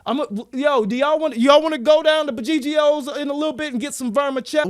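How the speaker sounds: high, projected invitation. Acted. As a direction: finally say it so it carries. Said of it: "Why the fuck didn't you say it like that".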